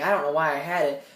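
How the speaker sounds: ordinary talk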